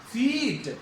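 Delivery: फीट with a long held ee; long vowel. Shown as a demonstration. Teacher repeating the long ई example, long vowel. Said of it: "ई की long sound जा रही है".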